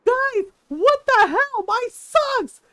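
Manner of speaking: high, loud, drawn-out mock yell; retold yelling. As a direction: imitate an outraged friend yelling. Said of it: "heard him yelling".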